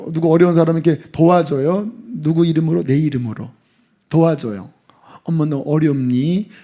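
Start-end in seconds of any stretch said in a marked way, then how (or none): 5.2-6.5 condescending. Pretend. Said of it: "이렇게 딱 주면서".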